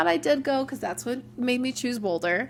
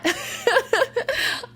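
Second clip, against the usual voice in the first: bright laugh; 13 semitones higher, 3 dB louder than usual. Real, not acted. Laughing after self-promotion joke.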